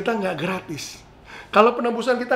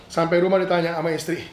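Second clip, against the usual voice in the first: ordinary talk, nothing marked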